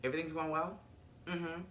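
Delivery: quiet, curt imitation; low-key, businesslike brush-off; short with her. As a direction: answer softly, giving as little as possible. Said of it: "Condola is really short with her".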